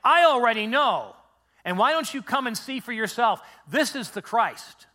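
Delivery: high, loud, emphatic testimony; convinced urging. Acted. Samaritan woman inviting townspeople to see for themselves, convinced. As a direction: urge them with complete certainty. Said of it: "She's saying".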